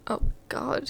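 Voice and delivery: ordinary talk, nothing marked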